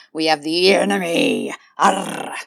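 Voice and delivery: half-hearted pirate growl ending in arr; a hint of a hammy pirate; football pirate voice. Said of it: "I tried to sound like a football pirate there".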